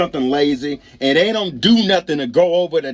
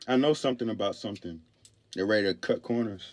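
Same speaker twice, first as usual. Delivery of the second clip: much softer, lower repeat; under the breath; 5 semitones lower, 10 dB quieter, faster than usual. Real, not acted. Speaker repeating his retort to himself, quiet and low. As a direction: drop it low, as if to yourself.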